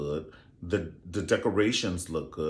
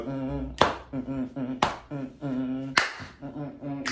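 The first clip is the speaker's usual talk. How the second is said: soft, hummed moans; teasing quiet mm sounds; mock moaning; 4 semitones higher, 6 dB quieter, slower than usual. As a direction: hum like she's riding him. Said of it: "she was like".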